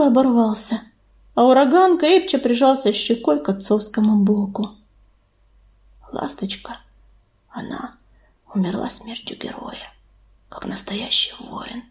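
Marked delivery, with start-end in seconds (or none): 6.1-11.8 very soft, halting near-whisper; hushed, grief-struck whisper. A narrator's reading. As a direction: barely get the words out, with pauses. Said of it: "прошептал он".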